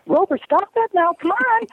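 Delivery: very high mock-female pleading with the dog; lifted, whining voice. Shown as a demonstration. Woman tells Rover to stop, high whining imitation. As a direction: squeal it high up, hurt and whining. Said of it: "when you lift your voice up".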